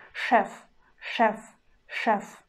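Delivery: Chef as шеф with hard ш, э sound; Russian demo word. Shown as a demonstration. Teacher demonstrates Chef to learners, hard ш. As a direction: model Chef like шэф. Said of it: "we pronounce not two sound letter".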